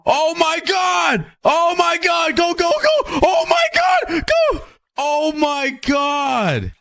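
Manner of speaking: shrieking in real terror, then slowly gasping it out; real fright. Real, not acted. Startled by explosion in game.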